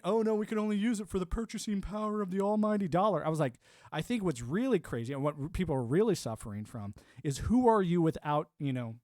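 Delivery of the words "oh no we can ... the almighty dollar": sneering mock of corporate talk; a mocking higher voice, lightly put on; mocking quote. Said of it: "quit saying".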